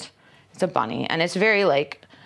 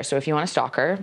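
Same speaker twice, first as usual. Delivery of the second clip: normal talking voice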